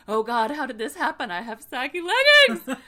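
high, loud wail breaking into laughter; mock despair. Bea mourning her saggy leggings, laughing mock wail. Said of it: "she's all that, and".

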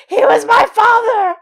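high, loud dramatic outburst; outraged shout. Acted. Angry boy confronting the witch over his father, outraged shout. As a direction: cry it out in full melodrama. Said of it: "he tells her".

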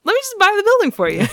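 high, fast, giddy gushing; eager high mock voice. Danny eagerly offers to buy the nurse's building, high giddy voice. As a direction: gush at her like an overeager rich boy.

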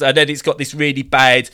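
ordinary talk

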